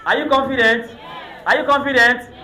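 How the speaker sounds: loud rally shout to the sisters; hyped crowd call. Real, not acted. MC repeating the hype call.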